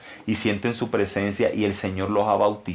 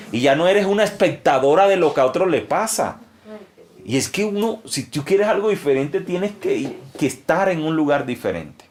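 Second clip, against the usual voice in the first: higher, louder preaching push; rising preaching; 7 semitones higher, 4 dB louder than usual. Real, not acted. A pastor exhorting a young woman to seek more, raised preaching. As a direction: lift the voice to exhort the listener.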